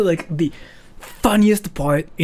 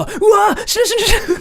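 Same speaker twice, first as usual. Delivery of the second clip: high, loud embarrassed wail; mortified wail; 5 dB louder, much faster than usual. Acted. Kid mortified in front of teacher, loud high wail. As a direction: wail like you want to vanish. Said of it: "It sounds so funny to me".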